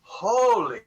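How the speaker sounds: drawn-out stunned exclamation; retold amazement. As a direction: let each word stretch in disbelief. Retells moment the truth sank in.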